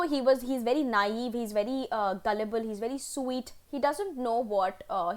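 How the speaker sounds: ordinary delivery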